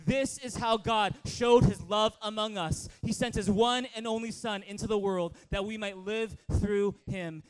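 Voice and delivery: loud scripture reading, every phrase driven out; loud, proactive reading. Shown as a demonstration. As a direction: read it out loud, leading the church. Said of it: "with a loud, out loud attitude".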